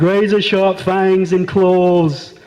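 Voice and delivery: slow, drawn-out ominous set-up voice; movie monster hype. Retold or imitated. Comic mocking films that set up the monster, hammy slow menace. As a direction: stretch out each menacing word slowly.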